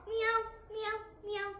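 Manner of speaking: high, short repeated meows; cat meows. Shown as a demonstration. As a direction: imitate a cat meowing. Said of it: "make very sound like cat".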